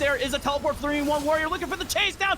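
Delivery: fast, high, excited esports commentary; live play-by-play. Real, not acted. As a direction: call the fight at full speed.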